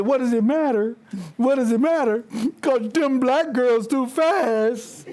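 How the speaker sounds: put-on teen girl quote dissolving into a laugh; imitating a teen girl. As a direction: play a sassy teenager, giggling as she finishes. Coach retells teen athlete's remark.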